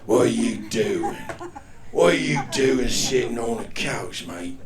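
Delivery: put-on slow, scolding Cockney accent; Jason Statham. Inner voice scolding him off the couch, mock Cockney. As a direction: berate in drawn-out Cockney. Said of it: "your guardian angel from Cockney".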